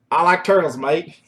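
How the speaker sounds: loud, badly faked Australian; horrible Australian accent. Host mocking own rhyme word, bad Australian accent. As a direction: put on a clumsy Aussie accent. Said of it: "That was a horrible Australian accent".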